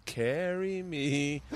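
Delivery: drawn-out mock child's plea; imitating daughter's plea. Dad mimicking his daughter in the street, long drawn-out plea. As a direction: stretch each word out like a kid begging. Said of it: "starts going".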